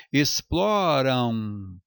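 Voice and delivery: "Exploram" bem lento, terminado em ãu; ditongo nasal decrescente. Shown as a demonstration. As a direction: estique "Exploram" e deixe o final cair em ãu. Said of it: "esse M tem o som de val". ("Exploram" arrastado, com M soando como U nasal)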